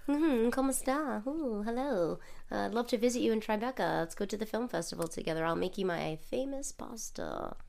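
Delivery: soft, breathy flirty impression; flirty Italian-American. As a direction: coo like a smitten fan. Mock De Niro fan flirting.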